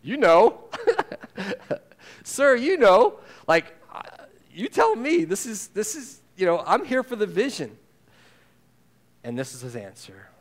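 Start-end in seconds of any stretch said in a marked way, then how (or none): 0.0-7.8 high, laughing mock reply, much higher and louder; a playful, laughing John, pitched way up; amused John. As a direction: play John tossing it back with a laugh. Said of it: "And John's answer, I love".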